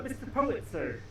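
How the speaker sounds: usual speaking voice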